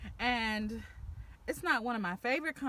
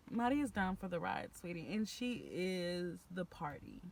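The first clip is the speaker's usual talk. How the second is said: ordinary talk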